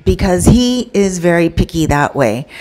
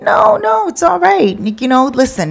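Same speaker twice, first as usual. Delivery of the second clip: much higher, fussing manager voice; high-pitched manager; 8 semitones higher than usual. Retold or imitated. Manager fussing over an unhappy customer, voice pitched way up. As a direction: squeak out the manager's reassurance. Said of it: "The manager's like".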